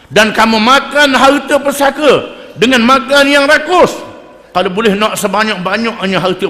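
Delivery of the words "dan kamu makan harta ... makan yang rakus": louder and higher, hammering the point home; rising sermon rebuke; forceful preaching. Real, not acted.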